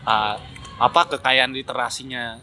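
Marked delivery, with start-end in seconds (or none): none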